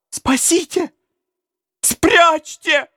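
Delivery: put-on high, terrified child's cry; frantic high child's shout; boy screaming for help. A narrator's reading. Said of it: "кричал он".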